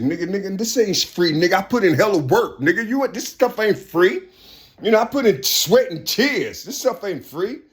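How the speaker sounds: high, loud indignant hustler voice; mock greedy hustler. Mocking greedy helpers, high indignant voice. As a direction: squeal it like a greedy guy guarding his work.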